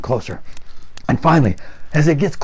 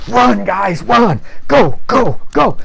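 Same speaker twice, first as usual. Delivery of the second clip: louder, higher shouted command; urgent yell; 7 semitones higher, 5 dB louder, much slower than usual. Acted. Scoutmaster yelling at the boys to run. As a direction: hurry the kids along.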